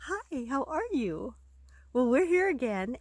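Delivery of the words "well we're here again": plain reading voice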